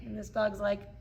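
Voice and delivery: normal talking voice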